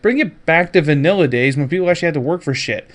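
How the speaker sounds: usual speaking voice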